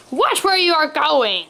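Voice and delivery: understated honk of annoyance; honking shout. A narrator's reading. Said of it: "Mister Boring honks".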